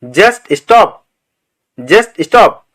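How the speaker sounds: ordinary delivery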